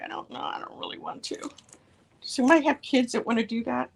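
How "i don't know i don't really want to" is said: put-on retelling her hesitant reply to the girls, whispered and unsure; reluctant near-whisper. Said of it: "And I'm like".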